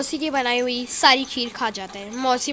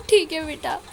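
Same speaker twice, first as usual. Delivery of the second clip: tearful goodbye; 5 semitones higher than usual. Acted. Aunt tearfully leaving Sonu.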